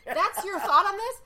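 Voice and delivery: loud, high disbelief with a laugh; shocked, laughing outrage; really, that's your thought?; incredulous. Real, not acted. Outraged at bragging customer.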